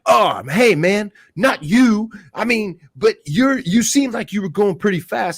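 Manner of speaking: high, slowed backpedalling voice; mock street guy. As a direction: play the guy caught out, nervously backing off. Voicing the guy in the road.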